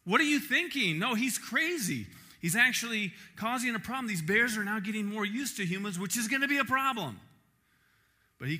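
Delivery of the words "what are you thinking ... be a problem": louder, higher alarmed warning; alarmed ranger. Retold or imitated. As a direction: scold him as an exasperated ranger. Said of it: "park rangers in the documentary are saying".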